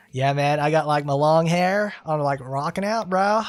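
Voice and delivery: surfer dude. Pretend. Voicing Shikinosuke for fans, loud surfer dude. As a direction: brag about your hair like a rocker bro. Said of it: "It's like".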